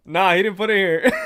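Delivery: can't hold in the laugh; laughing shout. Real, not acted. Telling the chat how the Target trip went, laughing shout.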